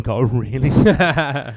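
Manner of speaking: slow, laughing through it. Real, not acted. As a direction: give in to laughter as you say it. Laugh heard, slowed.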